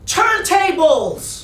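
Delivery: very loud, high, drawn-out American shriek; American-accented shriek. A narrator's reading. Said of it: "In a very loud American accent, she shrieks".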